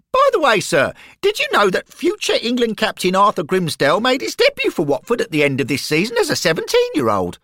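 high, fast treble reeling off facts; high, fast schoolboy voice; eager boy reciting facts. Acted. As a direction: show off your knowledge, high and quick. Derek regurgitating facts.

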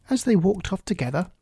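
ordinary delivery

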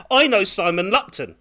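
sudden loud, high blurt. Retold or imitated. Starstruck producer blurts at [name], loud and sudden. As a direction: blurt it out before you can stop yourself. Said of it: "I just blurt out".

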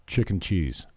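ordinary talk, nothing marked

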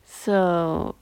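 ordinary delivery

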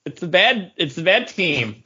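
usual speaking voice

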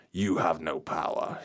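a slow, menacing whisper of an evil spirit; possessed whisper. Acted. Possessed Graham taunting the friends, slow menacing whisper. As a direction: hiss the threat like something has taken over.

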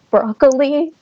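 stammering nerves. Retold or imitated. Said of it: "I was stammering".

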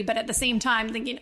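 usual speaking voice

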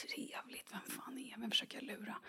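self-mocking whisper aside. Real, not acted. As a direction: hiss it to yourself in disbelief. Viskande självironisk sidokommentar. (viskande självironi)

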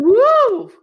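high, loud whoop; big cheering "woo". Retold or imitated. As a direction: whoop in support. Acted cheer for Philip.

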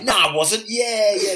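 high, loud indignant denial; mock denial. Friend denies having hands up. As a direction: deny it, half laughing.

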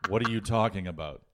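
usual speaking voice

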